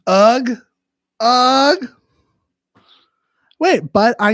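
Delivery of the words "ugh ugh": loud groan of disgust; disgusted ugh. Real, not acted. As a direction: groan it out, grossed out. Disgust at ASMR.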